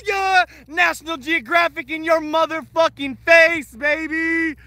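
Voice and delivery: high, loud victory yells; triumphant shouting. Real, not acted. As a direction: cheer the kill like a sports win. Dog catches the mole.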